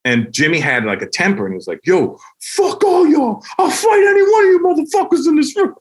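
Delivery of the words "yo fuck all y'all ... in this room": furious yell. Retold or imitated. Imitating the booed comic yelling at the crowd, high and loud. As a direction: scream a challenge at the booing crowd.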